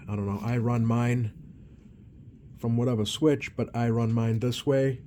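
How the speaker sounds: ordinary talk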